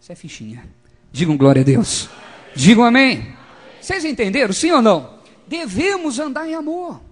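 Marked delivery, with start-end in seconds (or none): 1.1-7.0 loud, rising call to the congregation; preacher demanding a loud response; full-force preaching. Real, not acted. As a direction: belt out the exhortation, pitch climbing high.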